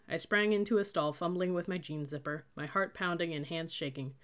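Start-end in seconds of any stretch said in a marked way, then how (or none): none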